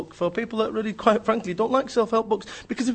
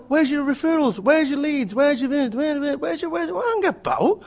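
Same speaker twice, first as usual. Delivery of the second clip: high-pitched, repetitive badgering; mock nagging; 8 semitones higher than usual. Networking group chasing a member for leads, high mock nagging. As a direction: pester with demand after demand, pitched up.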